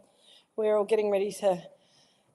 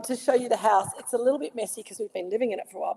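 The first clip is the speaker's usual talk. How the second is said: ordinary talk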